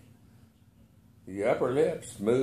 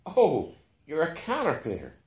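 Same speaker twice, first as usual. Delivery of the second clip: joyful; 6 semitones higher than usual. A narrator's reading. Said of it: "I explained joyfully".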